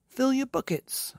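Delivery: high, commanding call; giving an order. A narrator's reading. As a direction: order the troops briskly. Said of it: "Mugglewump ordered".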